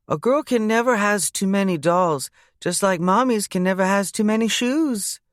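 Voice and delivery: put-on high, bossy small child laying down rules; little girl voice. Bailey telling her uncle he must do chores first, in a high child voice. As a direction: play a little girl lecturing an adult. Bailey sharing worldly wisdom.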